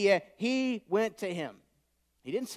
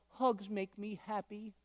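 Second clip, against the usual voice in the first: a soft, slow, lightly voiced small boy; little son's voice; 8 dB quieter, slower than usual. Father retelling his son's words, soft slow child voice. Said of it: "he goes".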